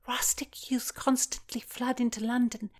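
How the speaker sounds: hushed whisper; whispered reassurance. Acted. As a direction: keep it secret, breathe the words. Secret talk among disguised girls.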